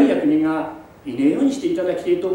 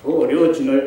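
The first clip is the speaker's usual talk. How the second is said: ordinary delivery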